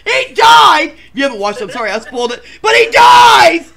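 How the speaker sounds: loud, high mock-grief shouting; hammed-up outrage. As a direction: wail it like a fan betrayed. Ranting about Michael Myers' death.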